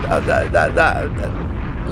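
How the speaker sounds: stuck repeating the word; real stutter, can't get it out. Real, not acted. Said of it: "Look, I'm stuttering".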